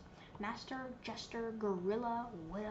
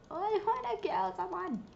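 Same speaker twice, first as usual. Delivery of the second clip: hammed-up menacing villain, higher and louder; mock murderous glee; 6 semitones higher, 5 dB louder, faster than usual. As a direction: play a cartoon killer out for blood. Gamer playing villain, wants kill.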